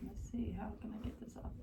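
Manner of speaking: fast muttered whisper; whispering to self. Real, not acted. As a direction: mutter it under your breath. Muttering to herself while working.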